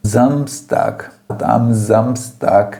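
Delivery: "Samstag" with a voiced Z-like S and a hard final K; every consonant clearly sounded in "am Samstag"; "Samstag" said slowly, the final "tag" as a crisp "tak". Shown as a demonstration. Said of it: "percebe como as consoantes são pronunciadas".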